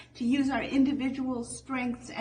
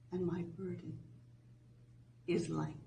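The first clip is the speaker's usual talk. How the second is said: scripture reading; 4 dB quieter than usual. Acted. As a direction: read it gently, letting each phrase settle. Slow scripture reading retaken.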